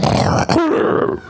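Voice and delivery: voiceless, hoarse shout of the name; a lion that has lost its voice; hoarse call. Acted. Calling the lion for the story, croaky and voiceless. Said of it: "Un león poco afónico".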